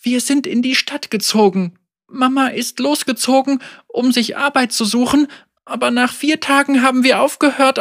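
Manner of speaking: put-on high, shaky young girl; a child close to tears; trembling girl voice. A narrator's reading. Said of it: "schlich sich ein Zittern in ihre Stimme".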